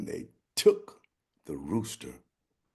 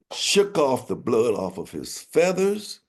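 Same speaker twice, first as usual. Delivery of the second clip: ordinary delivery, nothing marked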